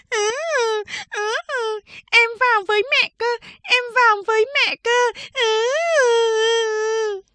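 put-on high-pitched little girl bawling and whining; wailing child. A narrator's reading. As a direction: bawl like a toddler wanting mother. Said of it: "Cầu Đệ gào khóc". (giọng bé gái gào khóc nhè, cao vút)

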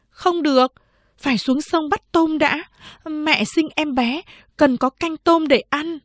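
high-pitched big sister voice; high, insistent elder-sister character; elder sister refusing. A narrator's reading. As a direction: voice a young girl saying no, pitched high.

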